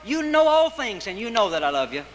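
irritated outburst that sinks as it ends; exasperated. Acted. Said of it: "Peter got exasperated and irritated".

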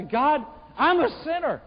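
impassioned, high-pitched confession; penitent sinner's plea. Acted. Sinner confessing to God he is the worst, impassioned plea. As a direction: cry out the confession, pitch climbing and staying high. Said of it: "I go before God and say".

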